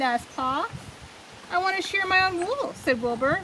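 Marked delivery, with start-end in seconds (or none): 1.5-2.7 higher, brisk, happy lamb voice; cheerful young sheep. A narrator's reading. Wilbur tells his parents proudly, higher and bright. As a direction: chirp it like an eager little sheep.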